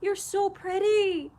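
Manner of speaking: high, slow, tearful-laughing gush; woozy gushing. Retold or imitated. Patient complimenting someone on waking, woozy gushing. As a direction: imitate yourself waking up besotted, voice drawn out. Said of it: "I just wake up and I'm like".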